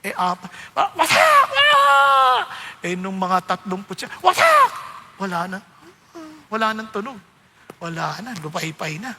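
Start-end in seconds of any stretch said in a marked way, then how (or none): 0.8-2.4 loud high scream of pain as the lash lands; pained cry. Acted. Father screams as the whip strikes him, loud and high. 4.2-4.7 loud high scream of pain as the lash lands; pained cry. Acted. Father screams as the whip strikes him, loud and high.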